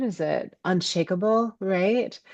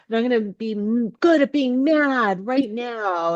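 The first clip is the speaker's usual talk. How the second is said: higher-pitched, playful fuming; acted defiant anger; 6 semitones higher, 3 dB louder than usual. Speaker resolving to be good at being mad, fuming. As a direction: throw yourself into being mad.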